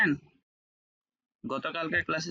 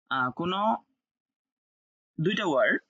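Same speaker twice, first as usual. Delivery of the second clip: ordinary talk, nothing marked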